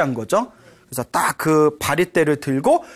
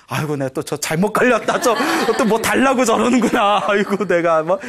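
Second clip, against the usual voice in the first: laughing mock grumble; 4 semitones higher than usual. Imagined grumbling of grandmother, laughing.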